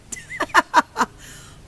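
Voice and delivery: squealing silly giggle; high giggle. Real, not acted. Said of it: "I'm the silly one".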